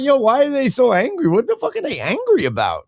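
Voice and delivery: high, bewildered questioning; baffled. Retold or imitated. Puzzled at the men yelling at Jason, high and bewildered. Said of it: "I'm like".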